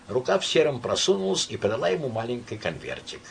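usual speaking voice

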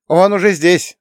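high, loud warning cry; alarmed yell. A narrator's reading. Ivan the Third warns everyone, yelling in alarm. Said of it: "заорал обычный, сдержанный Иван Третий".

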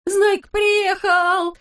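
very high, drawn-out cry; long, high-pitched jubilant yell; excited yell. A narrator's reading. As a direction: holler the news in a high voice. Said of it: "завопил Растеряйка".

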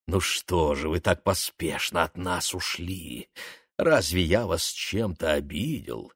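low, soft silky voice; seductive. Acted. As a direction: purr it to lure her out. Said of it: "голос был обольстителен".

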